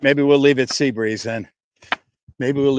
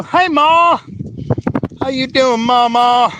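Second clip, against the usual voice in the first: cheerful greeting; 10 semitones higher, slower than usual. Real, not acted. Greeting his mother in the chat, high cheerful call. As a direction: sing out a greeting to mom.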